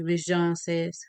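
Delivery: ordinary delivery, nothing marked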